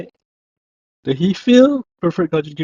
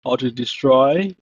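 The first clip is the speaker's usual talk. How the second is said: normal talking voice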